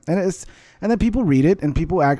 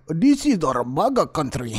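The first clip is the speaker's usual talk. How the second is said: slow, mock Nigerian accent; 3 semitones higher, slower than usual. Shown as a demonstration. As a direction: put on a hammed-up Nigerian accent. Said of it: "Now, if it's a Nigerian".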